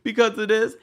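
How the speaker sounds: high, whiny pleading; mock crying Kandi. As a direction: whine like she's about to cry. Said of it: "then she starts crying".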